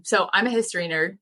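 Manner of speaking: ordinary talk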